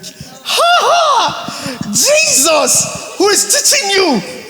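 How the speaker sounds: high, loud laughing outcry of disbelief; laughing exclaim. Real, not acted. Coach to choir singers, a high laughing shout of disbelief. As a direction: burst out laughing at the remedy list. Mocking singers' home remedies.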